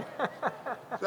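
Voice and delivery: quiet breathy chuckle; soft laugh. Real, not acted. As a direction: laugh it off softly. Laughs after the line.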